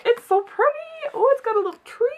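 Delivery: high cooing delight; very high, sweet fussing voice; high-pitched gushing. Real, not acted. Gushing over cover in high voice.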